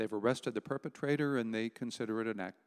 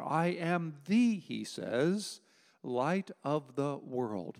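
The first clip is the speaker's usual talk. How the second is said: slow emphatic; much slower than usual. Acted. Preacher slowly stressing Jesus' claim.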